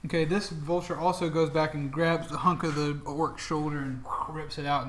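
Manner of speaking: usual speaking voice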